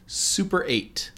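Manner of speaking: slow, drawn-out title announcement; dramatic reveal. Acted. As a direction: stretch out "Super eight" slowly.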